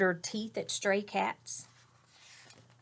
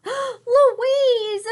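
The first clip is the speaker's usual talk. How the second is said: relieved cry; 11 dB louder than usual. Acted. Relieved cry on finding sister.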